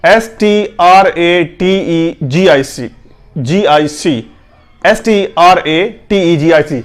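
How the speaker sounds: usual speaking voice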